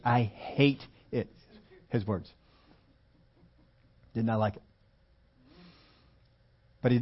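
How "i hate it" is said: slow, with "hate" stretched out; stress on hate. Retold or imitated. Preacher quoting the runner who disliked running, slowly stressing "hate". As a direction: draw out "hate" for emphasis. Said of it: "emphasis on hate".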